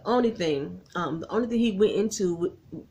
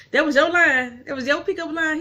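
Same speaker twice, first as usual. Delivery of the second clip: louder, higher gleeful accusation; delighted realization; 7 semitones higher, 6 dB louder than usual. Retold or imitated. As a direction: catch him out with a laugh. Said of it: "she was like".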